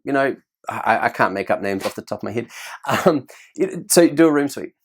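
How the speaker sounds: ordinary talk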